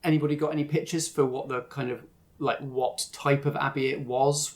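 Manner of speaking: ordinary delivery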